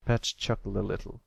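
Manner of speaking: ordinary delivery, nothing marked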